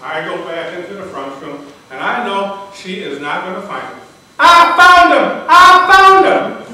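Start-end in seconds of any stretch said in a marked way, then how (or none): 4.4-6.6 high, full-volume cry of discovery; acted-out joyful yelling, drawn out; excited shout. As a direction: shout the good news through the house. Wife calls out the discovery.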